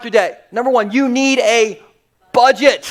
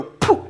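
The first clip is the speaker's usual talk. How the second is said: puffed "poof" of a toy gun; short popping mouth noise; imitated dart shot. Dad telling how the dart fired, imitated shot. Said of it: "and it'd go".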